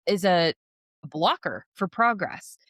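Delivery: ordinary talk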